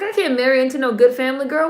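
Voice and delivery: fast, high, sassy retelling of her; sassy mock quote. Viewer mocking the film heroine in a fast sassy voice. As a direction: toss it off quick like a gossiping girlfriend. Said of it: "She's like".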